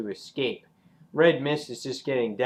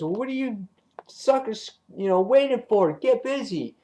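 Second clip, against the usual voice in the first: acted goading; 8 semitones higher than usual. Acting out Red Mist goading others.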